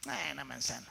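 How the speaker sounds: low, soft, dismissive "eh"; imitating an unimpressed onlooker; mock indifference. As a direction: shrug it off like nothing special. Shrugging off an everyday wonder.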